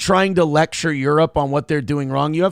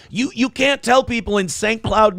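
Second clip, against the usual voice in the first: normal talking voice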